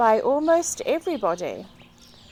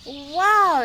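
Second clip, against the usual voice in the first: bright wow, higher and louder; excited exclamation; 5 semitones higher, 6 dB louder than usual. A narrator's reading. Will thrilled to hear his rope talk, excited. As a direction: burst out in delight. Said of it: "exclaimed Will".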